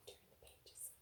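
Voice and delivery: breathy whispered aside. Real, not acted. Teacher whispering to the children. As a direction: whisper it under the reading.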